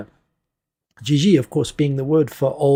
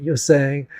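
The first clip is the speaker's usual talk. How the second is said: ordinary delivery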